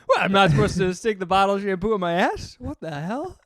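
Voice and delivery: high, loud comic indignation; incredulous outrage. Real, not acted. Co-host protesting a silly shampoo misunderstanding, incredulous. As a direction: protest in disbelief, voice climbing.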